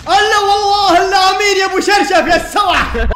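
loud heated yell at enemy; angry shout. Real, not acted. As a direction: shout him down. Gamer yelling at enemy player. (صراخ عالٍ على الخصم)

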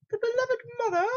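very high falsetto mother; put-on mother voice. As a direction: squeak it out as a mock mum. Voices the mother being named.

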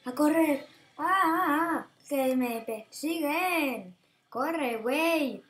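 panicked loud yelling; screaming while fleeing; being chased. Real, not acted.

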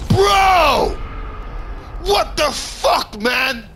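high, loud outburst of gamer rage; furious high-pitched shout; enraged shout. Real, not acted. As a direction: yell in outraged disbelief, dragging the words. Rages at being killed in game.

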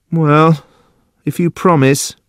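high-pitched, tentative giving in; hesitant Judson. Acted. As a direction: yield warily in a high voice. Judson gives in.

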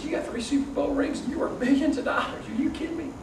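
high, disbelieving quoted reply; incredulous retort. Retold or imitated. Telling the Super Bowl champ he's kidding, high and incredulous. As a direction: act amazed he'd be inspired.

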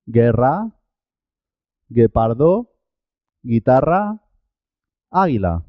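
"Guerra, guepardo, guitarra" in list tune, falling on "águila", U unheard; silent U after G. Shown as a demonstration. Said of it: "La U es muda en este caso".

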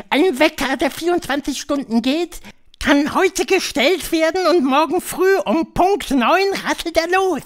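high, loud witch voice; high-pitched, theatrical witch leader; Grand High Witch. A narrator's reading. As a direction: lecture the coven in a high, imperious voice.

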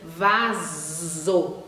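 "vaso" with the S held as a long buzzing Z; teacher stretching the Z in "vaso". Shown as a demonstration. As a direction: lean on the zz in "vaso". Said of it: "S com som de Z".

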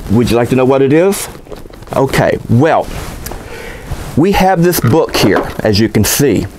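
ordinary delivery

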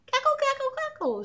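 high cackling hen cluck; clucking hen laugh. Acted. Mother hen laughing at Hedgie, high clucks. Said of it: "clucked and laughed".

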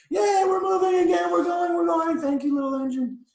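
very high, loud squealing cheer. A narrator's reading. Toys thanking the engine, cheering. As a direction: shout for joy like a crowd of toys. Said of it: "began to smile and cheer".

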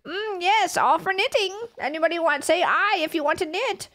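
host a knitting stream like a granny; old lady voice. Pretend.

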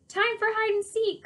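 high, lively call. A narrator's reading. Tommy calling hide and seek to the babysitter, calling out. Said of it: "called Tommy".

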